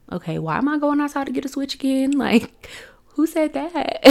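high, quick, baffled inner voice with a laugh; little girl puzzling over the odd order, mock-baffled and quick; confused inner thought. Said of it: "And I was just so confused of, like".

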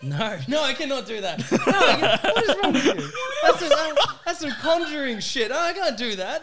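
high, loud helpless laughter; laughing refusal. Real, not acted. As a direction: crack up while protesting. Cracking up at the request.

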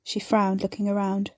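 plain reading voice

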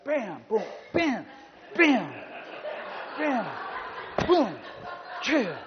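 high, loud Bruce Lee strike cries; imitated fight noises. Jumped man goes Bruce Lee on her, loud mock strikes. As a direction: punch out each blow as a comic kung-fu yell.

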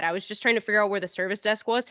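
usual speaking voice

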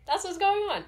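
high loud exclamation. Pretend. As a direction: belt it out playfully. Loud jokey outburst.

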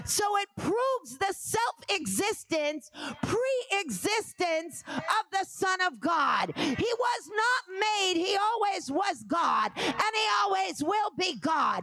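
shouted, high preacher climax; whooping sermon climax; preaching at full force. Acted. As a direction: shout it to the congregation.

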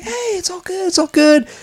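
high-pitched, happy mock cheer; excited. Tommy walks in with news he'll be made, excited. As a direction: crow it high, like celebrating good news. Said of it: "they're so excited for Tommy".

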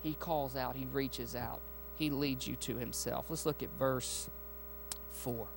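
soft, low preacher's calm; quiet preaching. Real, not acted. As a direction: bring it down to a hush.

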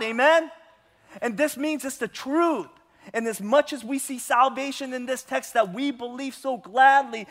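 loud, high-pitched sermon delivery; forceful preaching. Acted. Pastor preaching hard to his church, loud and high. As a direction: preach it out to the whole congregation.

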